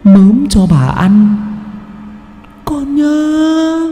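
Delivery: shrill possessed shriek of a hungry ghost; possessed child hissing. Acted. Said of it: "rít lên âm thanh thoát tục". (giọng ma nhập rít lên the thé)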